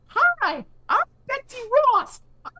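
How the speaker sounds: very high, put-on sweet caller voice; a daughter's very high pretend phone voice; fake kid voice. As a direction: chirp it up high like a kid pranking a phone call. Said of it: "I'm doing the fake voice she's doing".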